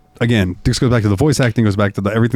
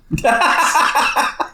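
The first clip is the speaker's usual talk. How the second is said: squealing laugh; high burst of laughter. Real, not acted. Joke banter bursts into laugh.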